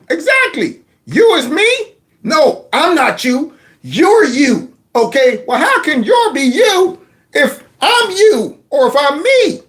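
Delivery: put-on high, bewildered old man voice rising to a squeak; muddled old man baffled by his own cue card; confused Joe. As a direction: play him lost and baffled, pitch climbing to the last word.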